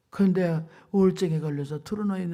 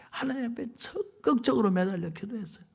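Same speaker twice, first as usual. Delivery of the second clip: ordinary talk, nothing marked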